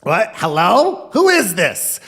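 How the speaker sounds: high, loud, confused hollering; hard-of-hearing grandma. Retold or imitated. Grandma who can't hear on the phone, loud high hollering. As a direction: bellow questions like a deaf old woman. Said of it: "this is how she talked".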